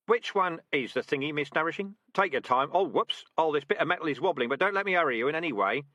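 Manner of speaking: teasing rat sergeant. Acted. Sergeant in the trap teasing a panicked recruit, mock patient. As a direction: needle her with false patience.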